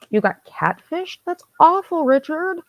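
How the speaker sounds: high-pitched mock dismay, rising at the end; incredulous, pitched well up; retold shocked reaction. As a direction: react aghast to a friend's bad luck. Retold shocked reaction to friend's catfishing.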